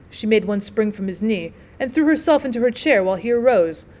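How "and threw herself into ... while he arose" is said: ordinary delivery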